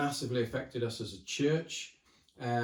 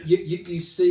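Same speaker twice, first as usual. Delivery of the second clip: laughing; 5 semitones higher, 11 dB louder than usual. Real, not acted. Laughing while talking.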